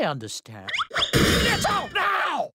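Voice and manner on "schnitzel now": loud order; commanding shout. Acted. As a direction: bark the order. Ordering Schnitzel loudly.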